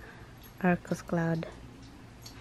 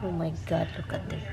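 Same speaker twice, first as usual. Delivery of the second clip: normal talking voice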